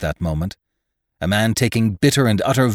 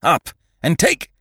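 angry shout; 9 semitones higher, 3 dB louder than usual. A narrator's reading. Ned fighting, cut off.

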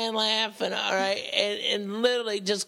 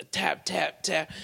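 ordinary talk

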